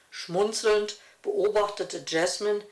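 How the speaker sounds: plain reading voice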